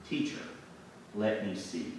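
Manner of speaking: soft, halting request; quiet plea. Acted. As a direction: ask humbly, softly. Beggar asks for his sight.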